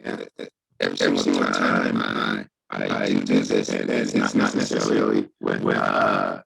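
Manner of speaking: distorted robotic voice over a bad connection; glitchy digital call audio. Real, not acted. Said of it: "[name], you sound like a robot".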